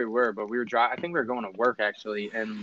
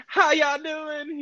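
high, drawn-out Southern lady greeting; Southern drawl; much slower than usual. Retold or imitated. Uber driver greeting her passengers in a high Southern drawl. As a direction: imitate a sing-song Southern woman's drawl. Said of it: "hears someone with an accent".

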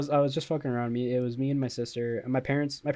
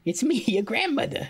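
grandma voice; 11 semitones higher than usual. Pretend. Playing the grandma.

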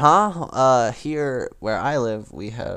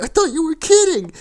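imitated high, loud yelp of protest; protesting kid; 9 dB louder than usual. Grounded friend answering his yelling mom, mock high-pitched protest. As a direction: squeal back like a scolded boy. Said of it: "And he's like".

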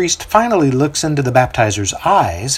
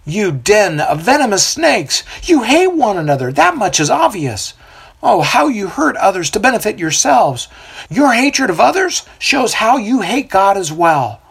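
accusing; 9 semitones higher than usual. A narrator's reading. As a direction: rebuke them as vipers. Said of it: "the baptizer accuses".

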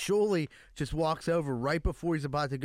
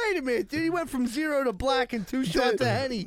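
amazed; 9 semitones higher, 4 dB louder than usual. Retold or imitated. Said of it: "And I'm like".

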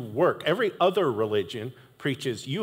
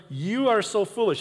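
higher, a touch of an angry apostle; mock scold; 7 semitones higher than usual. Paul scolding the Galatians, lightly mad. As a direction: scold them. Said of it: "He's like".

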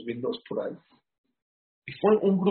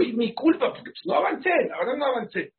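distraught lady; 10 semitones higher than usual. Retold or imitated. Lady after the phone call, higher and near tears. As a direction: cry out your own fault like a little girl. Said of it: "empieza a llorar ella como una chiquita".

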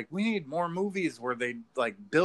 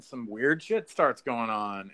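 normal talking voice

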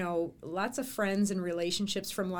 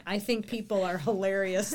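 ordinary delivery

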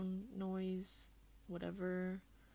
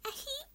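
tiny squeaked sneeze. Shown as a demonstration. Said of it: "Or do I be like".

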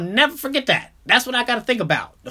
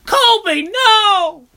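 high, loud anguished yell; anguished cry; 13 semitones higher, 8 dB louder than usual. Retold or imitated. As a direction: cry out as the ball gets wrecked. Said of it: "I'm like".

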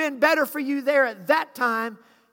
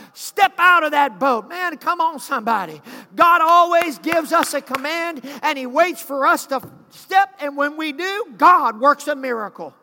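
loud climbing shouted sermon; preaching at full force; 6 dB louder than usual. Acted. Preacher rousing the congregation with shouted commands. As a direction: build the crowd up, shouting each line.

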